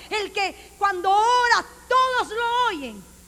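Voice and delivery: loud, rising preacher's cry; fiery preaching. Acted. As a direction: preach it out at full force. Preacher rising to full force.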